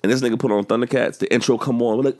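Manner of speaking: ordinary talk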